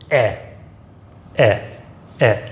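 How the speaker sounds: short open "eh", falling; accent grave sound on "eh"; muted French è. Shown as a demonstration. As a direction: demonstrate a muted "eh" vowel, each one separate. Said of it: "this one is a little more muted".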